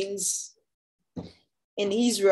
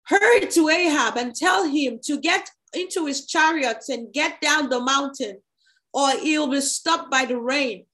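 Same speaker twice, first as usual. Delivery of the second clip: urgent call pitched up, without real volume; mild narrated shout; 6 semitones higher than usual. A narrator's reading. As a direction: read it as a restrained shout. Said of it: "Then Elijah shouted".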